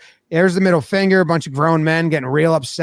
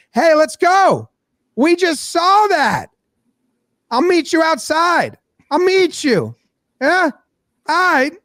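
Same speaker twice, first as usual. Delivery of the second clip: high-pitched challenge, drawn-out words; confrontational; 10 semitones higher, slower than usual. Retold or imitated. Pitcher challenging his rival outside, high confrontational voice. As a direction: act out a fired-up player calling someone out.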